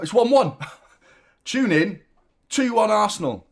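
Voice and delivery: high, loud disbelief at the score; incredulous shock. Retold or imitated. Fan reliving the goal, high loud disbelief.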